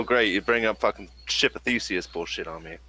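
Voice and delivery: ordinary delivery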